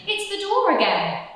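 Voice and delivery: high, loud exasperated cry; not again!. Acted. Percy exasperated by knocking.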